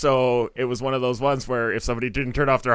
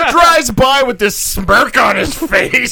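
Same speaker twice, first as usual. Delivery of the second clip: loud, high, drawn-out indignation; slow it down in exaggerated outrage; mock road rage; 11 semitones higher, 10 dB louder, slower than usual. Acting out comic road rage story.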